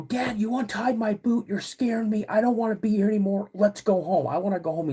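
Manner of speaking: put-on high, pleading child's complaint; retold panic, high pitched and easing down at the end; imitating crying kid. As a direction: beg to go home like an upset kid. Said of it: "I'm absolutely crying".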